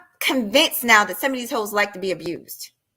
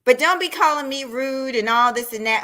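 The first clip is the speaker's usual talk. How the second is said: ordinary talk, nothing marked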